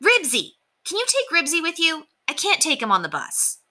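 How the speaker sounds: lightly voiced boy, keen to be off; a hint of a boy reassuring the driver; eager boy Henry. A narrator's reading. Henry asks about his dog.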